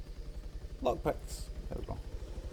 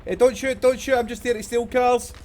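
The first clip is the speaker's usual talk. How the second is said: pleading shout, higher and louder; panicked surrender shout; 4 semitones higher, 7 dB louder than usual. Real, not acted. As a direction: yell don't shoot, hands up. Pleading with armed players.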